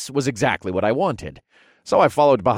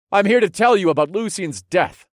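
much higher, louder call; called out loudly; 10 semitones higher, 4 dB louder than usual. Acted. Narrator announcing the death to the Duke, loud. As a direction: project across the hall. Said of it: "I called out in a loud voice".

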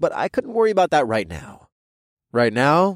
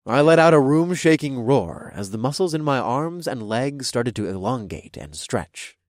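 ordinary delivery, nothing marked

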